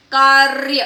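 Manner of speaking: कार्य as loud "kaarrr-ya"; long trilled r in कार्य, no vowel after it; correct rolled r. Shown as a demonstration. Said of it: "मगर हमें क्या करना है".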